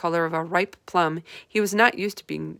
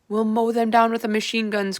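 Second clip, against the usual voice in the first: loud, higher army officer's cry; gruff cry; 4 semitones higher, 5 dB louder than usual. A narrator's reading. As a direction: bark it out like a keen general. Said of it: "cried the head of the army".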